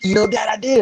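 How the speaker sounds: put-on high child's voice, proud; proud little daughter. Daughter telling dad she did it, high child voice. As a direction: beam like a small girl who did it. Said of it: "she was just like".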